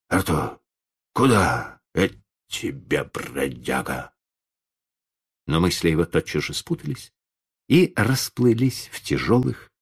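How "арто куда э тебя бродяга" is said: half-asleep, drowsy call to the dog; lazy sleepy call. Acted. Old man dozing, calling his dog lazily. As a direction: mumble it lazily, eyes still shut. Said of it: "лениво с закрытыми глазами окликнул".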